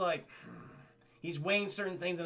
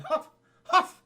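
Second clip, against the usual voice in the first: high, loud mock huff; indignant huff; 10 semitones higher, 9 dB louder than usual. Princess huffs at the captain's defense, indignant. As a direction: huff twice like offended royalty. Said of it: "And she's like".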